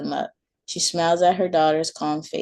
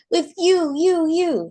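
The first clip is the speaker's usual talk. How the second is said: put-on high, louder child's shriek; a toddler throwing a fit; screaming child; 12 semitones higher, 4 dB louder, slower than usual. A narrator's reading. Maylee screaming at her mom, high loud child voice.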